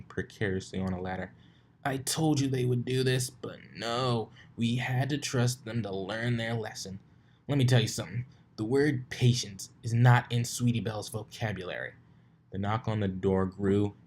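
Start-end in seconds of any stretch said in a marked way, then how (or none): 1.8-11.9 higher grumbling dragon voice; Spike complaining. A narrator's reading. Spike grumbles about Sweetie Belle, higher and fed up. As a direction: grumble like a fed-up little brother.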